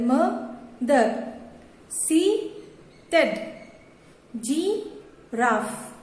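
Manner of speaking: very slow, each word broken into two beats; syllable by syllable. Shown as a demonstration. Teacher showing two-syllable words, each cut in two by a pause. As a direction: split "मदर", "seated", "giraffe", "parrot", "active", "happy" in two with a pause inside. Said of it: "यहाँ पर कितने break हो रहे हैं".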